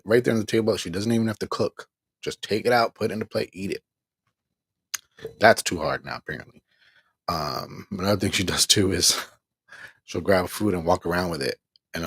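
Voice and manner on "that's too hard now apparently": normal talking voice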